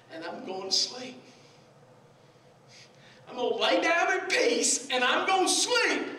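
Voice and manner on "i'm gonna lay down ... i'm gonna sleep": loud, high, emphatic delivery; raised-voice preacher quoting scripture; preached declaration. Retold or imitated. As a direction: proclaim it to the whole congregation. Same verse repeated, preached loud.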